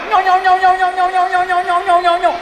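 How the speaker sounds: high, nonstop nyau-nyau gibberish; mock nagging. Shown as a demonstration. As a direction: imitate endless grumbling on one high note. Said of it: "Ngomel banyak ya". (tiruan ngomel tanpa henti, nada tinggi)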